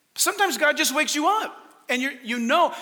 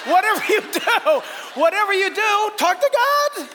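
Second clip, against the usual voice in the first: high shout, building higher and louder; exuberant exhortation; 9 semitones higher, 5 dB louder than usual. Real, not acted. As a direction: belt it out to the congregation, climbing to a peak. Preacher builds to exhortation climax.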